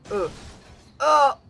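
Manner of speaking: loud high wailing Santa no; anguished shout. Real, not acted. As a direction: cry out in mock despair. Santa crashes again.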